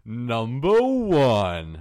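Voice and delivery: slow, loud countdown announcer; Watch Mojo. Acted. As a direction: stretch it out as an online list-video host. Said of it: "like a Watch Mojo video".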